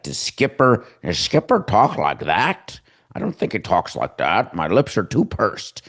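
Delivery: fumbled imitation of a drawling accent; attempted John Skipper drawl. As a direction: try on a drawl, not quite landing it. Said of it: "can I do a drawl".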